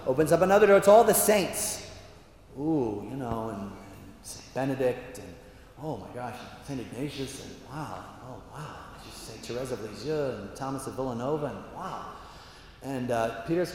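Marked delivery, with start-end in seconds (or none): 2.6-12.1 soft, low murmurs of wonder; quietly overawed, naming each one; awestruck unease. Acted. Friars seeing all the saints, hushed awe. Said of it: "starting to get second thoughts".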